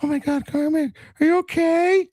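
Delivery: high falsetto fretting mother; squeaky mock-concerned mom voice; worried mom. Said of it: "But she go".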